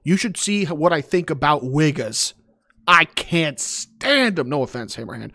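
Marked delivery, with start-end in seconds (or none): 2.9-4.5 slow, loud stress on "stand"; emphatic disgust. Pretend. Mock rant about Wiggas.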